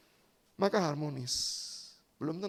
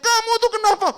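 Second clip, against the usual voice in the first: much higher, louder furious yell; angry husband; 12 semitones higher, 9 dB louder than usual. Acted. Husband yelling at his wife over the dead cat, furious. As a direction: let him explode at his wife. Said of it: "langsung marah kepada istrinya".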